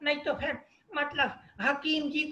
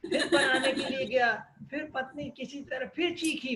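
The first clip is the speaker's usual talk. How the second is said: ordinary talk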